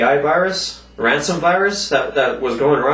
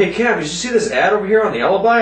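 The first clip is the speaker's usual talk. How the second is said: higher, fast, eager friend voice; 6 semitones higher, faster than usual. Retold or imitated. Friend telling Cam about the newspaper ad, eager and fast. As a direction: rush it out like a keen friend. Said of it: "He's like".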